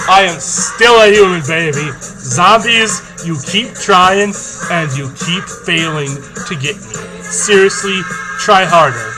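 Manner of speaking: loud, cocky taunt at the zombies. Acted. Taunting the zombies who chase him.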